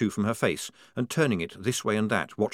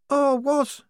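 put-on high, loud worried girl's voice; anxious young woman; 5 dB louder than usual. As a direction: fret in a high voice. Shufti voiced.

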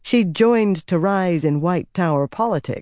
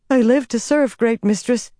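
much higher anxious submission; worried, servile; 8 semitones higher than usual. A narrator's reading. Servant repeating her pledge to the figure, worried. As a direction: plead obedience with rising worry, pitched well up. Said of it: "growing more worried".

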